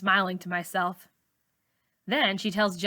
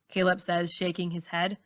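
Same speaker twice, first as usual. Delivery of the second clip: usual speaking voice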